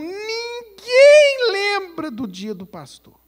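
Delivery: high, loud, slow hammed-up wail; mock crying lament. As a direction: wail it like a wounded pastor. Acted pastor lament, crying. (lamento choroso exagerado, agudo e lento)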